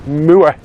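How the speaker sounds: "Moor" said as "muah", long vowel with the r dropped; Yorkshire "Moor" pronounced "muah". Shown as a demonstration. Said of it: "Don't forget the oor".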